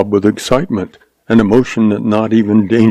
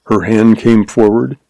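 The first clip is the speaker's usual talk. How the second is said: usual speaking voice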